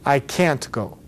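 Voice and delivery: "can't" with its A held clear; full open vowel in "can't"; emphasized A. Shown as a demonstration. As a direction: stress "can't" over "go". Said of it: "the A emphasized".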